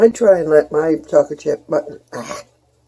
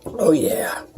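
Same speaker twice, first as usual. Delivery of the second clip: breathy whispered delight; whispered oh yeah; 5 dB quieter than usual. Real, not acted. Savoring coffee while filming a cooking video, whispered.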